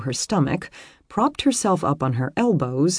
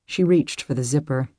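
plain reading voice

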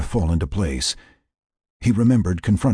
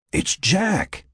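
high voice heard inside the head; a lightly voiced mind voice calling; whispery telepathic voice; 12 semitones higher than usual. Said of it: "Frank heard the voice come again".

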